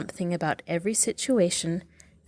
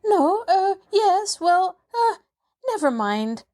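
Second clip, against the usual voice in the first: much higher flustered stammer, rushed; 7 semitones higher than usual. Acted. Maid covering her slip to the girl, stammering. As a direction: trip over the words in a panic. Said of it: "stammered Nancy".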